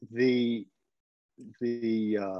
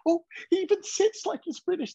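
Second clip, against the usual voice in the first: very high mock-girlish chitter; chittering girls. Enamored girls gossiping over the British guy, high chittering. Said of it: "they'd chitter at each other and say".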